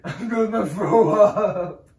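hammed-up distress breaking into laughter; mock upset. Boyfriend teasing his girlfriend, mock distress with laughter. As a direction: pretend to be about to cry, laughing.

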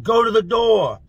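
high, urgent acted call. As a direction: holler an instruction to a friend. Calling out to cellmate.

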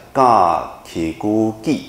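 ga ki gu gi spelled out evenly and slow; slow recitation. Shown as a demonstration. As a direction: lead the repeat slowly. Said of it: "念一下".

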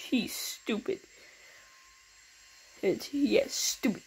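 whispered aside; whispering. Real, not acted. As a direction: whisper the insult. Whispered insult.

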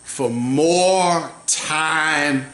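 low, slow devotional murmur; hushed prayerful preaching. Real, not acted. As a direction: bring it down to an intimate prayer. Quiet slow worship after climax.

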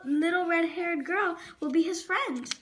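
plain reading voice

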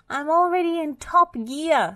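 high, loud straining character voice; spluttering Muck. A narrator's reading. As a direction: protest loudly in a high, put-on voice. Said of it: "spluttered Muck".

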